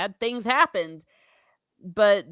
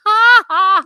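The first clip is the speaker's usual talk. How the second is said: loud laugh; 11 semitones higher, 13 dB louder than usual. Real, not acted. Laughs at a viewer's joke.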